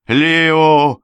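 loud, high, held cry; anguished cry. A narrator's reading. Rubinstein seeing Lio struck by the dagger, loud cry. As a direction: cry out the name, high and long. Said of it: "закричал Рубинштейн".

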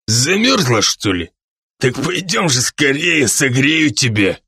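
put-on drunk's lewd invitation; leering drunk. A narrator's reading. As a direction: coax her along like a lecherous drunk. Drunk propositions trembling waitress. (пьяное похабное заигрывание)

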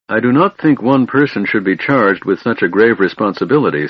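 plain reading voice

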